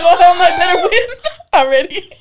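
high, loud laughing protest. Retold or imitated. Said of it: "I'm like".

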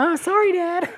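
squeaky high daughter voice, hammed up; badly faked little girl voice; high girly voice. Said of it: "That's not very good".

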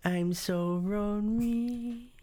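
slow, sad singsong puppet voice with r for l; Kim Jong-il puppet voice. Retold or imitated. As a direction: drawl it slowly like the lonely puppet dictator. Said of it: "that's Team America".

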